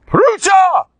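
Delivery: shrill, loud scolding yell; screaming. Retold or imitated. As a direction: shout down the immodest women. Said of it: "I saw him screaming".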